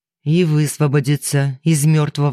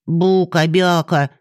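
pixie; 2 semitones higher, slower than usual. Acted. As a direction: tease like a cheeky tiny fairy. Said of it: "показала ему язык Кроха".

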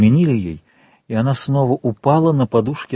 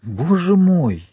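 higher, slow, drawn-out gasp of dismay; understated alarm, stretching the words higher; alarmed exclamation; 7 semitones higher, slower than usual. A narrator's reading. Wife facing her husband at the locked door, alarmed.

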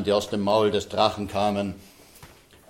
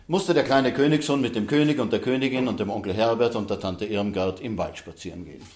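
ordinary delivery, nothing marked

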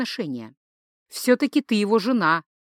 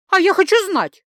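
high, loud, stubborn outburst; insistent demand; 11 semitones higher, 6 dB louder than usual. Acted. Wife demands the truth from rival, loud and insistent. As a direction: insist loudly, pitched right up.

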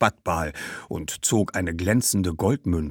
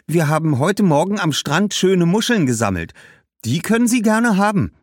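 put-on high, pleading girl's voice; refuse, then offer something else; protesting young girl; 9 semitones higher, 3 dB louder than usual. Girl defending grandmother's bag and offering shells, protesting.

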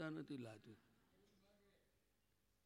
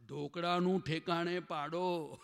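laughing quip; 7 dB louder, slower than usual. Real, not acted. Joking aside with laughter.

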